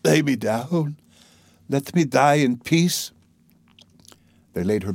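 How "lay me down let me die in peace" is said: slow, weary plea from a dying woman; lightly voiced dying mother. Dying mother asks to be laid down, lightly voiced weariness. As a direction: plead wearily, drawing the words out.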